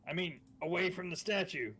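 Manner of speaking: loud, high in-character explanation; shouting back. Acted. Player explaining the trap to Casimir, shouting back. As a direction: holler it over to someone far off.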